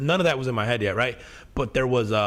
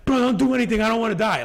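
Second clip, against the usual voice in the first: much higher and louder, imitating a panicked friend yelling; retold yelling; 10 semitones higher, 4 dB louder, faster than usual. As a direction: mimic a terrified friend shouting from the car. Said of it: "yelling at you".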